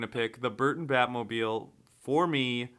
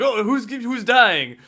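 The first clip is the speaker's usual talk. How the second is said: high, loud, slow mock worry; mock Commissioner Gordon; 9 semitones higher, 9 dB louder, slower than usual. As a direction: play the worried commissioner. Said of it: "he's like".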